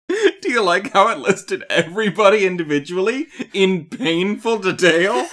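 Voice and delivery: tickled, words riding on giggles; laughing. Real, not acted. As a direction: laugh while pointing out the absurdity. Amused by own plodding summary.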